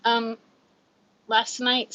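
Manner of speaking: ordinary talk, nothing marked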